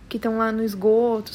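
usual speaking voice

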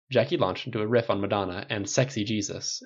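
usual speaking voice

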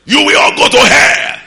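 very high, full-blast doom cry; fire-and-brimstone shout. Retold or imitated. Annoyed hungry young preacher to the crusade crowd, fire-and-brimstone shout. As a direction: scream damnation at the whole crowd. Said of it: "I preach as if rapture will happen that day".

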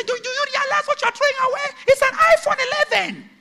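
high-pitched disbelieving cry; outraged protest. Pretend. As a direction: play a vain woman aghast at losing her phone. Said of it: "You say".

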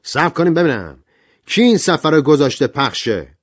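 loud, high-pitched cutting in; interrupting. A narrator's reading. Young guest demands who played the record, interrupting loudly. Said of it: "صحبتشان را قطع کرد".

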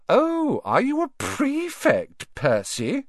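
high, drawn-out fake astonishment; mock surprise. A narrator's reading. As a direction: feign great surprise to tease. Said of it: "with an air of great surprise".

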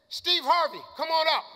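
host summoning an act to stage; calling out. Acted. Host calls comic to stage.

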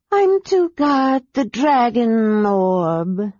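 slow, drowsy dragon voice; sleepy dragon mutter. A narrator's reading. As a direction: mutter it like a half-asleep dragon. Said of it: "Sleet muttered".

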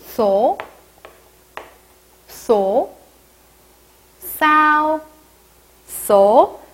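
싸오 and 소 with s between ㅅ and ㅆ; slightly tense s. Shown as a demonstration. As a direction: model 싸오 and 소 one by one with a tense s. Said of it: "쌍시옷보다는 살짝 약한 발음".

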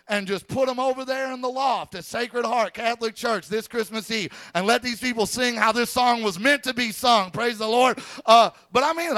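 high-pitched, excited preacher delivery; excited pulpit exclamation; fervent preaching. Real, not acted. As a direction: exclaim it high, riding on enthusiasm.

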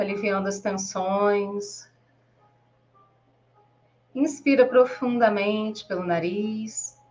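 slow, measured meditation voice with long pauses; guided meditation. Acted. Guide leading listeners to breathe and relax, slow guided-meditation voice. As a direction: guide the listener gently through breathing, drawing words out.

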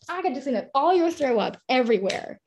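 ordinary talk, nothing marked

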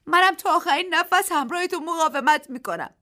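high, loud, strained; tearful vow. A narrator's reading. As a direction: promise with all she has. Daisy vows, after crying.

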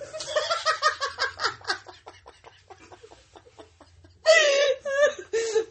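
wheezing laugh, almost crying; high laughing fit. Real, not acted. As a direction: laugh until you can't breathe.

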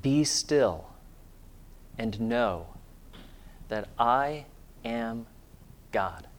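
soft and slow, each phrase left to settle; quiet meditative repetition. Shown as a demonstration. Pastor models meditating on the verse, soft and slow. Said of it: "let's just meditate on these words".

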